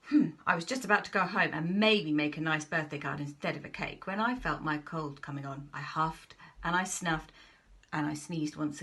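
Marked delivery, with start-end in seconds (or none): none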